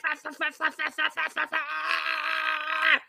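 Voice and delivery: high roaring mock rant; roar, then rattle off curses into a drawn-out yell; hammed-up raging bear imitation; aggressive bear. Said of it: "like, an aggressive bear".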